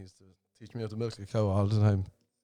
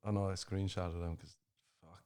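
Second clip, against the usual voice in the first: ordinary delivery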